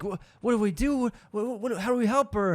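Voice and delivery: high, stammering mock plea; panicked begging. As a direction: imitate a helpless man begging for a way to help. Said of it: "Jeff's begging".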